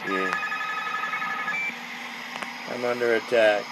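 ordinary delivery

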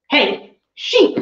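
loud, high call across the field; shout. A narrator's reading. Stormhound yelling at the dumb sheep. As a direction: yell at the sheep to get their attention. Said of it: "the Stormhound shouted".

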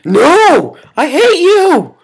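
high, loud frustrated cry; real gamer rage; angry yell. Real, not acted. Raging at the game.

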